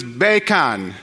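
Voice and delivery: "bacon" slowly in a put-on Jamaican accent. Shown as a demonstration. Preacher comparing two accents, "bacon" slowly in Jamaican accent. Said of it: "as a Jamaican".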